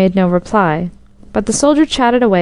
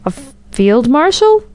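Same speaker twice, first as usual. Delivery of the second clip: stammered, high rising question; desperate guess; 5 semitones higher than usual. A narrator's reading. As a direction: stumble on the first sound, pitch climbing anxiously. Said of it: "and then in desperation".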